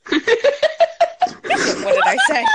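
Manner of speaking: high, loud surprised question; startled, loud and high. Real, not acted. Surprised at missing it.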